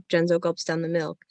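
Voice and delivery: ordinary talk, nothing marked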